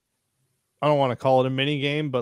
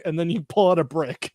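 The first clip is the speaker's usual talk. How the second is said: ordinary delivery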